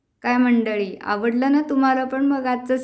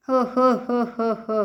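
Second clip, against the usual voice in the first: churning laugh; slower than usual. Pretend.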